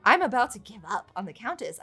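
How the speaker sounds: usual speaking voice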